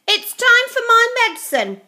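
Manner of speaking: put-on high, loud old woman calling; bossy old grandma voice; grumpy grandma. A narrator's reading. Grandma calls from next room.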